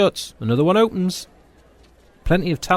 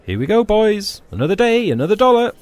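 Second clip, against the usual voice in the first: loud, high rallying call to the group; rousing call; 9 semitones higher, 5 dB louder than usual. Acted. Mate rallying his running team, loud and high. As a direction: gee the boys up, loud and high.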